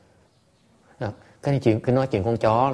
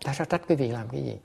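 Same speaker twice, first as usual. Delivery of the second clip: ordinary talk, nothing marked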